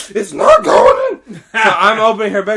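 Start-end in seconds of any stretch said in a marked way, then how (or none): 0.1-1.3 high, loud screech of frustration; crying scream. Retold or imitated. Little girl failing to get it in, crying scream. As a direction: screech it like a bawling child. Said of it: "crying and just like".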